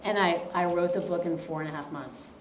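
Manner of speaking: normal talking voice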